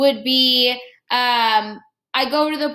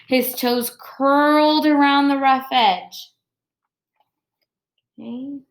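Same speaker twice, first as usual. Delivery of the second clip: expressive picture-book read-aloud; reading to children; 3 semitones lower than usual. Acted. Teacher reading Jabari Jumps to her class, expressive read-aloud. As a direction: read the story warmly to the class, pausing on each step.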